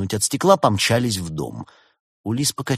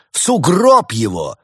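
loud, high, slow battle cry; indignant antiquarian rallying others; outraged exclamation; 7 semitones higher, 9 dB louder, slower than usual. A narrator's reading. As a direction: demand punishment at full voice. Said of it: "воскликнул антиквар".

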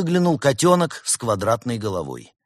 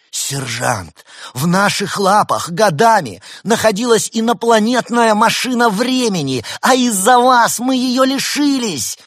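roaring fury; 12 semitones higher, 8 dB louder than usual. A narrator's reading. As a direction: tear into a subordinate. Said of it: "взревела Полигона".

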